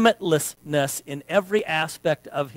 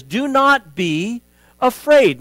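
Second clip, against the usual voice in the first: slow, raised, commanding; God's command; 7 semitones higher, 6 dB louder, slower than usual. Acted. Preacher voicing God to Joshua, slow and commanding. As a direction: lay each word down like a decree.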